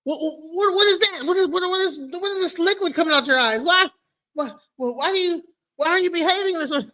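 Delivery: high, stammering fake alarm; mock bewilderment. Imitating people who can't handle raw emotion, mock bewilderment. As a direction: sputter as if tears were a baffling sight. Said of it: "when a person kinda emotes, it's like".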